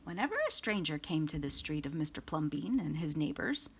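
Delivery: ordinary delivery, nothing marked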